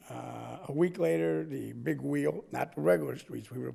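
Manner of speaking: usual speaking voice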